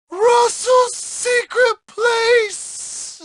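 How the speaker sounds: very high, slow squeal; groin-hit agony. Acted.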